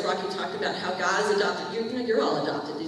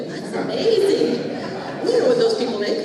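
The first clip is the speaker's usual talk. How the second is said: amazed; 5 semitones higher, 8 dB louder than usual. Retold or imitated. Tax man's amazed reaction.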